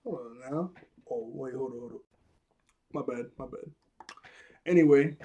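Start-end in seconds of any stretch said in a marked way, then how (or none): 0.0-3.7 soft, lower nervous retreat; quiet backing down. Retold or imitated. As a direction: back off quietly and sheepishly. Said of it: "he's like".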